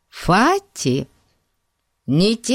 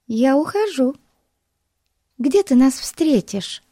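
put-on high young girl's voice; 9 semitones higher than usual. A narrator's reading. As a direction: voice the little girl. Betsy voiced.

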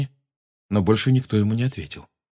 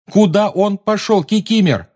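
high, loud pressing repeat; urgent shout; 12 semitones higher, 5 dB louder than usual. A narrator's reading. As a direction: demand louder the second time.

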